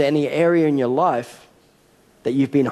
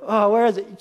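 mock searching; 8 semitones higher than usual. Father pretending to search.

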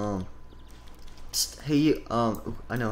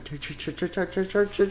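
ordinary talk, nothing marked

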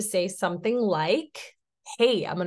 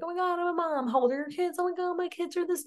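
much higher, gushing Oh my God; gushing mom chatter; 10 semitones higher, 4 dB quieter, faster than usual. Retold or imitated. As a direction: coo excitedly with a fellow mom. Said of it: "And I'm like".

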